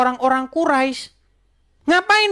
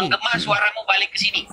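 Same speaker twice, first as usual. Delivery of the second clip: fast echo coming back over the line; faster than usual. Real, not acted. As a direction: voice bouncing back through a call.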